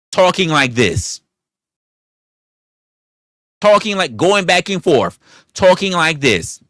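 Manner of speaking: indignant, pressing the point home; heated rant. Real, not acted. Streamer ranting at a critic about panel content, heated and loud.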